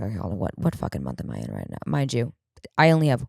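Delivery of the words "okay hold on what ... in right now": muttering to herself. Retold or imitated. Confused inner thought.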